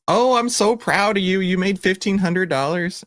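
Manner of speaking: high, gushing proud mom voice; mom's proud gushing. Retold or imitated. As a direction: coo like a delighted mother. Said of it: "she was like".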